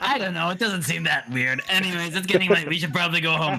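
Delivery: high, hammed-up innocent deflection; change the subject in a high, hurried fake-casual voice; Jacquette. Jacquette deflects about third-person habit.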